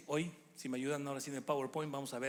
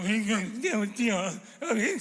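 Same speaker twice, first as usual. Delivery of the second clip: squeaky nervous student, louder; voice cracking high; 5 semitones higher, 8 dB louder, slower than usual. Retold or imitated. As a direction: let the voice crack up into falsetto. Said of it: "se les aflauta la voz".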